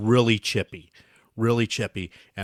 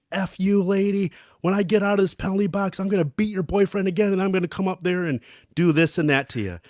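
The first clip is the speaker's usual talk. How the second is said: mock threat; 7 semitones higher than usual. Teammate threatens from penalty box.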